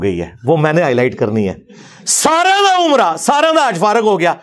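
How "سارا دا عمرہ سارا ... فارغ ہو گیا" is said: high, slow mock dismay at a wasted pilgrimage; sarcastic, much higher, slow lament; mocking exclamation. Real, not acted. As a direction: drag it out in a higher, mocking wail. Mocking the tale's outcome.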